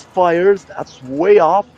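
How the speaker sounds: usual speaking voice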